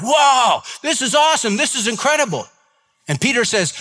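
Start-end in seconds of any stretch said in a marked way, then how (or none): 0.0-2.5 preacher voicing the amazed crowd, hammed up; excited crowd. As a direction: cheer like a stunned crowd at a miracle. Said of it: "the people are like".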